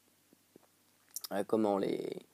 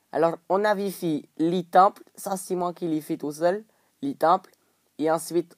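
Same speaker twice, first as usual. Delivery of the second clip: attempted Arabic accent; 2 semitones higher, 3 dB louder than usual. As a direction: put on a lively, amateur Arabic accent. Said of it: "Moi, j'arrive vraiment pas, les gars".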